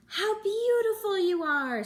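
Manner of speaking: high, loud delighted gush; admiring crab. A narrator's reading. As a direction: gush over the anemones. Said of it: "said the Hermit Crab".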